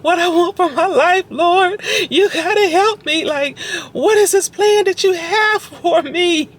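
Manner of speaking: tearful pleading prayer. Retold or imitated. Retelling her prayer in the closet, crying high and pleading. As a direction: beg God through tears in a high voice. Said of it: "And I was like".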